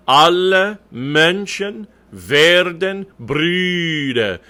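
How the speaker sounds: "Alle Menschen werden Brüder" very slow, held l in "Alle"; double L in alle. Shown as a demonstration. Coach modelling for the choir, slow emphatic German diction. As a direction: stretch each word, lingering on the l of "Alle". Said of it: "The L in alle should be a double L".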